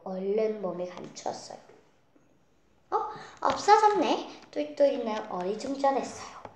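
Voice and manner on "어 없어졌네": 훨씬 높고 크게, 느리게 놀라; 깜짝 놀란 아이처럼; 놀란 목소리. Acted. 가자미가 사라져 놀람. (깜짝 놀라 높게)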